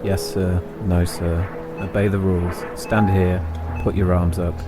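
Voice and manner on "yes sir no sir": ordinary delivery, nothing marked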